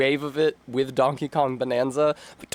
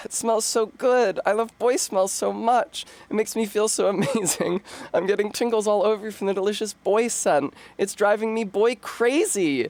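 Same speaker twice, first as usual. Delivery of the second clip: put-on high, dreamy boy-crazy swoon; swooning read; 7 semitones higher than usual. Reading a fan message aloud as a swooning girl, high. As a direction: gush over the boy scent, pitched up. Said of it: "I should have done an angry read".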